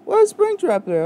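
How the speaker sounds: very high-pitched squeal of confusion; alarmed disbelief. Real, not acted. Gamer suddenly spotting Springtrap, alarmed high squeal. Player baffled by a sudden character.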